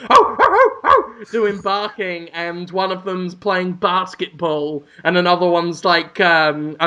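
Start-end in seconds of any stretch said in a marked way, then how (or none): 0.1-1.2 high, louder crow calls; crows cawing. Retold or imitated. Retelling the ad, high crow cawing. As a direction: caw like a line of crows.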